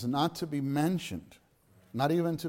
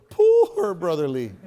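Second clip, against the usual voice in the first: louder, slow, pouting put-on lament; imitating Brother Lee; 4 semitones higher, 9 dB louder than usual. Preacher acting out his teacher's pouting lament, louder and slow. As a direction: push the lips out and sigh the name. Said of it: "how he would put his lips go".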